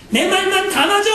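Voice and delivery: put-on stubborn child. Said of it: "라고 주장을 하는 어린아이".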